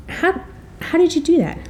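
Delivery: high, marvelling "how"; stunned question. Retold or imitated. Reader asks author how he did it, marvelling. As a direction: ask it as if baffled. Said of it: "amazed reader asks author".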